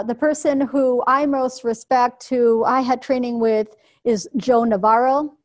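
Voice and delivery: usual speaking voice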